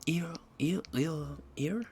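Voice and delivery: high, put-on French accent; hammed-up French accent, pitched well up. Joking about being hungry for croissants, put-on French accent. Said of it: "how to pronounce ear in a French accent".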